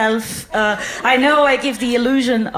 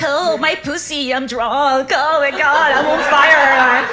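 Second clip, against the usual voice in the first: hammed-up drunk brash comic, getting louder; mock drunk Amy Schumer style; 2 semitones higher, 4 dB louder than usual. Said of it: "going to go very Amy Schumer".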